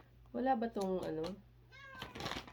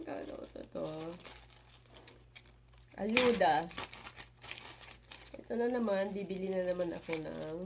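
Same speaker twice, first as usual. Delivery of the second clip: normal talking voice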